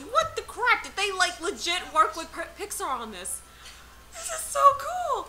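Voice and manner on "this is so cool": high, excited cry; real delighted squeal; thrilled. Real, not acted. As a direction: gush with the pitch way up. Thrilled live reaction to game trailer.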